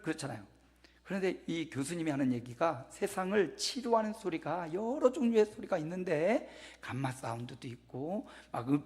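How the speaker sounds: normal talking voice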